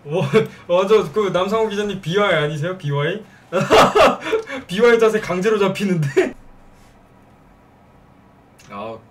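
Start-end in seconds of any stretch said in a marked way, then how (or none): 3.5-4.6 loud laugh breaking out; burst of laughter. Real, not acted. Laughs at Beewhy joke.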